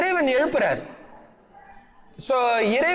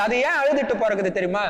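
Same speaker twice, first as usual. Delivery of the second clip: ordinary talk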